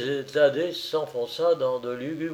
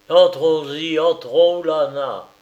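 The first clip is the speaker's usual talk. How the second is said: louder, pressing lord; insisting; 3 semitones higher, 7 dB louder than usual. A narrator's reading. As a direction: insist hard that she come in. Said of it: "insistait Thaddée".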